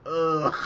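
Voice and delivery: loud disgusted ugh; loud groan. Retold or imitated. Viewer groaning at another reference, loud disgusted groan. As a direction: groan at the screen. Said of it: "And I'm just like".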